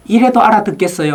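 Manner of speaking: 높은 음으로 으스대듯 묻기; 듣는 사람을 떠보듯 거만하게; 잘난 체하는 발표자. Retold or imitated. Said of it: "어렵게 발표하는 사람이 있거든요". (거만하게 떠보는 말투)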